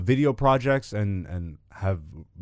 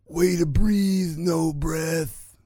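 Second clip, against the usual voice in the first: slow, higher cartoon-bully Simpsons accent; Jimbo accent; 5 semitones higher, much slower than usual. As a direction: drawl it like Jimbo from the Quickie Mart. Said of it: "I even gave it to you with the accent".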